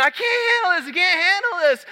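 put-on high, loud child's panicked wail; retold repeated scream. Son still screaming on the coaster drop, a high panicked wail. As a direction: keep screaming it like a terrified kid. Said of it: "he kept saying it".

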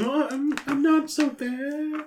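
very high, slow pleading squeak; high slow wheedling; pleading cat. Acted. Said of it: "affection to convince him".

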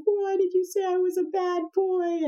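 a hint of a tearful child asking why; hurt little boy. Son questioning his mother after being scolded, mildly tearful and high. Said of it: "he starts crying".

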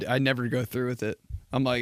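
usual speaking voice

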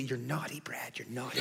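very soft, low mom aside; playfully chide, almost under the breath; coy mock scolding. Mom teasing her husband mid-story, hushed coy scolding.

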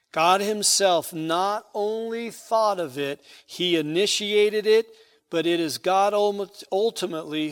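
loud, ringing pulpit cadence, hitting each God; booming scripture read from the pulpit; preaching at full force. Acted. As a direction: proclaim it to the back pews. Builds to emphatic climax.

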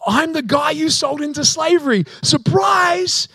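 high, loud mock-triumphant shout; spring it like a comic surprise; hammed-up jubilant announcement; gleeful reveal shout.

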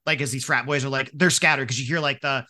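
normal talking voice

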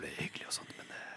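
very soft, chatty whispering; teasing whisper. Pretend. Co-host goading the whisper-hater, whispered. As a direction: keep whispering just to provoke her.

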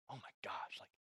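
breathless whispered awe at the view; awed whisper. Retold or imitated. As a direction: whisper it as if stunned by beauty. Awed by snowy mountains at sunrise.

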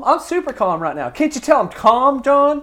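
high, loud, not calm at all; insisting he's calm while fuming; agitated. Real, not acted. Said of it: "you're about to jump out of your shirt".

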